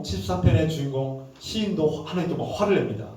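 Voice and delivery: ordinary talk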